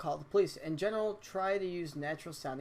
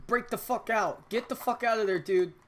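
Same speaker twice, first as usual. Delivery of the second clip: urgent shout; 5 semitones higher, 5 dB louder than usual. Acted. Urging escape through window.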